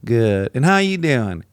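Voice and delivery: Southern small talk. Retold or imitated. Callers trading endless pleasantries on the phone, Southern drawl. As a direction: imitate Southern small talk going round. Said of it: "now it's his brother".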